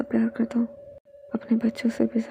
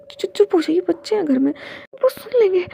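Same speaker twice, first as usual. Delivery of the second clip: flustered, higher hush-up with a stammer; embarrassed shushing; 7 semitones higher, 3 dB louder than usual. Acted. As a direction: hush him up, red-faced and stammering. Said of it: "उसे शर्म आ रही थी".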